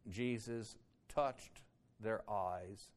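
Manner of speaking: ordinary delivery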